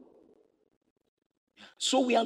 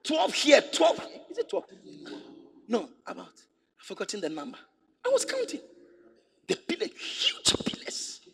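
high, amazed counting; awed excitement; 6 semitones higher than usual. Retold or imitated. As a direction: marvel at the huge pillars.

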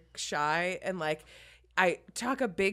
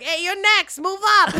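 impatient lady; 13 semitones higher, 11 dB louder, slower than usual. Retold or imitated.

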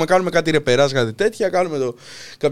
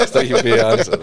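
higher, through real laughter; laughing; 6 semitones higher than usual. Real, not acted. Guest amused that his friend already told the story, laughing. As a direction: repeat it while cracking up. Cracks up at remembered joke.

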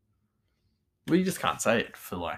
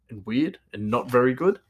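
ordinary talk

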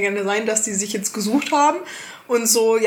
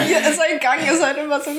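laughing; 7 semitones higher than usual. Real, not acted. Laughing at trailer scene.

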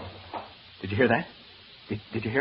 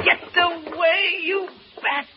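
high, loud shriek of alarm; panicked shriek; 4 dB louder, much slower than usual. Acted. Man fighting off a swooping bat, panicked shriek.

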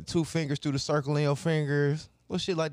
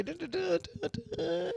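high-pitched vocal air horn blasts; air horn imitation; 6 dB quieter than usual. Friend adds all the Jamaican sounds, air horn imitation. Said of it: "I think you get an air horn".

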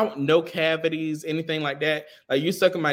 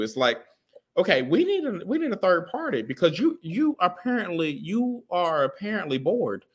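usual speaking voice

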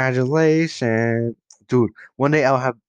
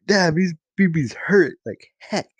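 mock pain; 7 semitones higher than usual. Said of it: "You'd be like".

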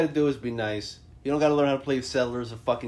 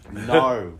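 a flat, louder Australian "No."; Australian accent; 4 semitones lower, 4 dB louder than usual. Shown as a demonstration. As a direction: toss off "No." in a mock Australian accent. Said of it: "Let me say that in Australian".

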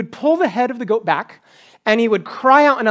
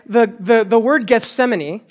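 normal talking voice

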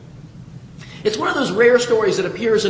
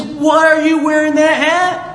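loud, higher-pitched indignant demand; mock-outraged scolding; 6 semitones higher, 6 dB louder than usual. Father confronting his son over a Yankees hat, mock outrage. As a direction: scold him like an appalled parent.

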